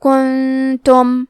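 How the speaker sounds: کنتم recited slowly with the نون hidden in ghunna. Shown as a demonstration. Teacher reciting ikhfa example for students, slow. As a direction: draw out کنتم with held ghunna. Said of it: "حکم کو غنہ کے ساتھ ادا کریں گے".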